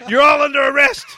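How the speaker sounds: high, loud cop shout; frantic shouting hostage taker. Pretend. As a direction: bellow like a panicked marshal. Acting out air marshal.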